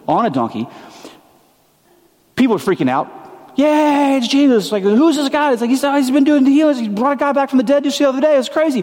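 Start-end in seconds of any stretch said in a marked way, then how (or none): 3.6-8.8 fast-talking excited onlooker; excited crowd. Acted. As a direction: gush like a fan who saw a miracle.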